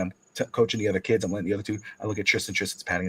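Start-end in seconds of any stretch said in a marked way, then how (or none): none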